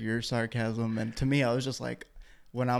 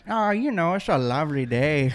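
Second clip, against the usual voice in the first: cheery mock Canadian accent, pitched up; 5 semitones higher, 5 dB louder than usual. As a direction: play a chirpy Canadian neighbour. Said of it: "the Canadian accent, eh?".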